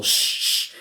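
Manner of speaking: long unvoiced shush; drawn-out "shh"; retold hushing. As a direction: hush someone who is screaming. Retold men hushing his screams.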